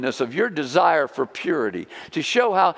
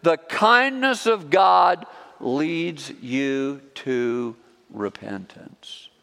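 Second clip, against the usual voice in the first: slow emphasis; much slower than usual. Acted. Emphatic reading of scripture verse.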